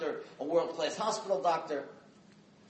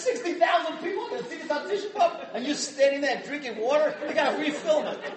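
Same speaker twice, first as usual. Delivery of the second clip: high, loud, incredulous scolding; hammed-up indignant yell; mock outraged caller; 9 semitones higher, 7 dB louder than usual. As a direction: act out an appalled caller for laughs. Acting out angry phone caller.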